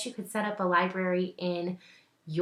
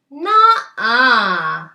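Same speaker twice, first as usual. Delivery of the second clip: refusal; 4 semitones higher, 14 dB louder than usual. Acted. Mimi refuses brother.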